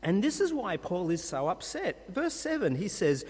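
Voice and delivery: ordinary talk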